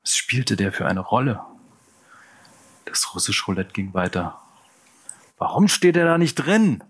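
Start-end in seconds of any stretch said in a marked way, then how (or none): 5.4-6.8 much higher and louder, shouting; angry shout. Acted. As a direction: yell it at the gang. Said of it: "rief der Anführer".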